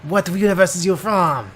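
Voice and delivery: hammed-up outrageous French accent; co-host echoing the intro in a mock French accent; fake French accent. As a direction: ham up a jokey French accent. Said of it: "this is my usual accent".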